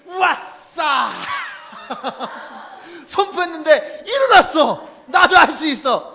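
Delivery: hammed-up boastful Peter crowing over a miracle; mock gloating shout. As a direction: Peter shouting with laughter at his own miracle. Imagined Peter boasting after healing.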